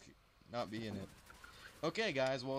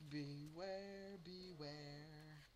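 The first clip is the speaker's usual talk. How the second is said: spooky ghost voice; 2 semitones higher, 11 dB quieter, much slower than usual. Pretend. Spooky warning in game.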